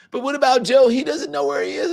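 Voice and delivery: high, loud whiny protest imitation; mock objection. Trump supporter changing the subject to Joe, mock protest. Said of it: "they were just like".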